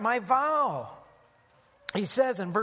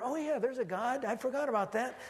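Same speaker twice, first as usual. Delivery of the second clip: higher-pitched, mock-casual recollection; offhand afterthought; 5 semitones higher than usual. Preacher mimics someone casually remembering there's a God, mock offhand. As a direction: toss it off like a forgetful believer.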